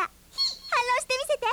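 put-on girl daring the fox to argue, slow and smug; triumphant challenge. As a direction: dare him to argue back, savoring the win.

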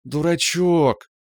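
high, slow, drawn-out and loving; tender singsong. A narrator's reading. Girlfriend teasing her sweetheart, tender singsong. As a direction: sing it to him fondly. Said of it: "нежно пропела Рита".

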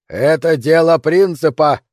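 slow, loud declaration; shouted retort. Acted. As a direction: call it down stubbornly. Defender shouts back in quarrel. (упрямый протяжный выкрик)

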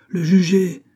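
high, loud demand; cried out. A narrator's reading. Several electors answering Bailly's question, crying out. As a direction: shout the verdict, pitched well up. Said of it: "s'écrièrent plusieurs voix".

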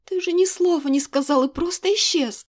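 arguing with the king; outraged reproach. Acted. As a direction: hurl the blame at him. Heated quarrel, heroine.

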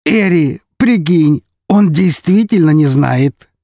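high, drawn-out delighted exclamation; gleeful amazement. Acted. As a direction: share the joke with your accomplice, excitedly. Count gleefully shares his amazement. (с восторженным изумлением, высоко)

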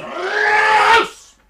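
high furious scream; furious shout. Acted. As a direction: scream him out. Throwing neighbour out again.

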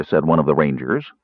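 ordinary delivery

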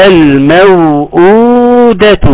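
"الموءودة" very slow, second waw held long. Shown as a demonstration. Teacher hinting at what is being looked for, long waw. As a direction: stretch the oo in "الموءودة". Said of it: "أنا بدور على إيه؟".

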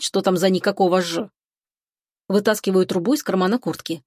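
plain reading voice

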